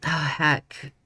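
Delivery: usual speaking voice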